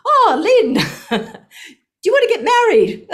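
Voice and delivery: high, loud, slow and laughing; laughing question. Retold or imitated. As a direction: beam it through laughter like a jolly mother. Laughing mother figure voiced.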